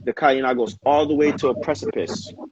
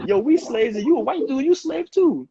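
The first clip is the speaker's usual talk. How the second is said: much higher, playful voice for the enslaved Africans; imitating the Africans; 13 semitones higher than usual. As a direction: pitch it way up as a caricatured slave voice. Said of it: "they're like".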